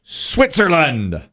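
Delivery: high, long-drawn call to the board; drawn-out host's reveal; game show reveal call. Real, not acted. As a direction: stretch it out for suspense, then let it fall. Game show host calls for the board.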